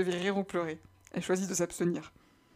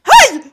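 Aïe crié très haut et fort; un cri aigu, une douleur soudaine; 22 dB louder than usual. Acted. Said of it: "s'écria Stacy". (Stacy crie de douleur en pleine séance)

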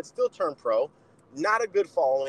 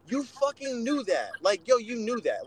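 you fucking knew that, higher and slow, pressing each word; exasperated scolding; 6 semitones higher than usual. Real, not acted. Scolding fighters who blame promoters, slow exasperated rebuke. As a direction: lay it on them like they should have known better.

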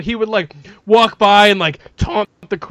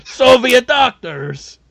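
mock villain boast; slower than usual. Imagining the tall player boasting to the crowd, slow booming mock villain. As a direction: ham it up like a Soviet-built supervillain. Voicing the eight-foot player.

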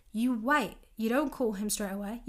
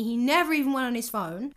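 ordinary delivery

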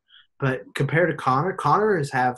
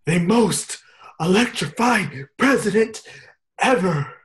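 higher, slow announcer hype; mock wrestling announcer build-up; announcer intro; 6 semitones higher, slower than usual. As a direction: drag out electrifying like a ring announcer. Announcer hyping The Rock.

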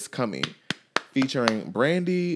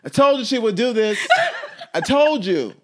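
laughing; 10 semitones higher, 7 dB louder than usual. Real, not acted. Laughing at co-host's antics.

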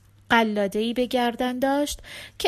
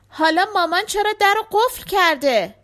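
calling through a door; 10 semitones higher, 8 dB louder than usual. Acted. Father asks through the door.

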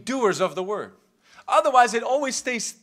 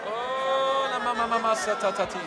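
praying in tongues. Real, not acted. Preacher still praying with the church, subdued tongues. Prays in tongues more softly.

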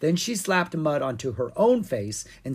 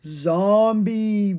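zombie voice; 7 semitones higher, 6 dB louder, slower than usual. A narrator's reading. Owen in zombie act.

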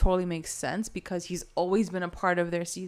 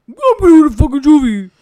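very loud, higher outburst; mock yell; 7 semitones higher, 16 dB louder than usual. Idiot Archie announces his decision to court, mock yelling. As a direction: holler it as a hammed-up declaration.

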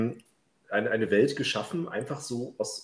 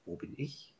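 whispered; 2 semitones higher, 13 dB quieter than usual. Retold or imitated. Inner question, unvoiced.

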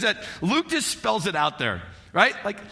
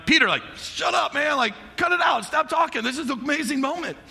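James and John hushing Peter; 6 semitones higher than usual. Retold or imitated. Friends hushing blurting Peter on the mountain, urgent and higher. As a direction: hiss at him to stop talking. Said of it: "James and John are like".

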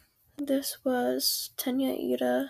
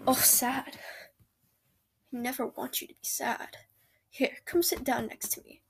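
ASMR whisper. Acted. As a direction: whisper gently, close to the ear. Whispered comforting boyfriend roleplay.

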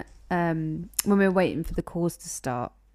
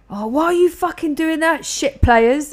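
loud, raised mock outrage at the team; imitated gamer rage; 7 semitones higher, 6 dB louder than usual. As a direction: imitate someone yelling at useless players. Imitates Ash raging at FIFA.

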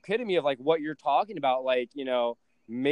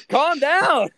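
higher, louder mock calm down; exasperated; 12 semitones higher, 8 dB louder, slower than usual. Son telling his mom to calm down, much higher and louder. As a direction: snap at her to calm down, hammed up. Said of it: "And I'm like".

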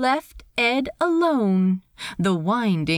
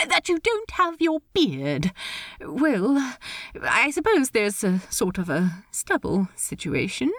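Steed, politely awkward; 2 semitones higher than usual. Acted. Steed advises Ed.

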